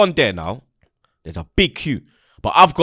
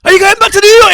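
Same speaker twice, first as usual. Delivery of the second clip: loud, very high imitated question; hammed-up starstruck fan voice; excited fan; 12 dB louder than usual. Fan at Heathrow asking about New York, excited.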